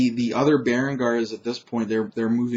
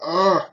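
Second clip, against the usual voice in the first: louder, higher acted grunt of pain; 7 semitones higher, 6 dB louder than usual. Storyteller acting out a stabbed knight, pained grunt. Voices Bernard being stabbed.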